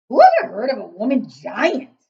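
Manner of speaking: loud booming giant shout. Acted. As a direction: shout it out indignantly. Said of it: "shouted the B F G".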